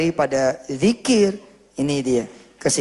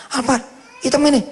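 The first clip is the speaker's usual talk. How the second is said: put-on high, astonished child's question; amazed boy; 8 semitones higher, faster than usual. (anak kecil terheran-heran)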